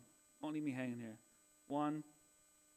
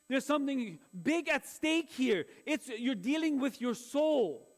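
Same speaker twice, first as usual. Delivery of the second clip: high, forceful exhortation, building louder; raised-pitch pulpit delivery, hammering key words; impassioned preaching; 8 semitones higher, 6 dB louder than usual. Acted. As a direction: exhort the congregation with rising urgency.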